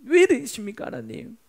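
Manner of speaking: high outburst of grievance, dropping away at the end; mock complaint. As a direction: wail your protest to God, then let it sink. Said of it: "하나님께 원망, 불평을 늘어놓고". (원망하듯 높게 따지며)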